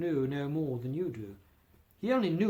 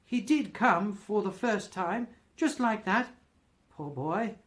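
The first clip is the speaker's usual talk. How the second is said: higher, heightened reminiscing; extraordinary excited voice; 5 semitones higher, 4 dB louder than usual. A narrator's reading. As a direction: between laughing and crying, earnestly wistful. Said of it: "in a most extraordinary voice".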